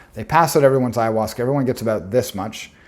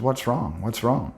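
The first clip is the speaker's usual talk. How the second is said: playful tone; 5 dB quieter, slower than usual. Retold or imitated. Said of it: "has this playful tone to her voice".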